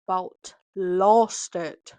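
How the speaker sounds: loud, slow, dragged-out exasperation; exasperated. Real, not acted. Venting to viewers about a stressful weekend with her kid, loud and drawn out. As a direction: lean hard on lost, drawn out and weary.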